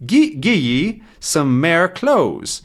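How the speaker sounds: high, slow imitated Scottish; Scottish accent. Shown as a demonstration. Teacher echoing shepherd's words, slow Scottish accent. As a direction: draw out the Scottish dialect words. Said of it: "he has a bit of an accent".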